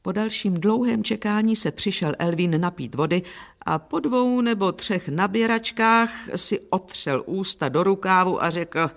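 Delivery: usual speaking voice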